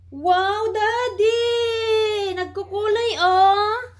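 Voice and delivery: high, drawn-out squeal of delight; excited wonder. Real, not acted. Parent showing Daddy the colouring pen, excited wonder. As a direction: gush in a high, singsong voice.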